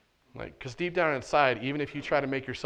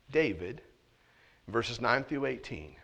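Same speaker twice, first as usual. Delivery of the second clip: normal talking voice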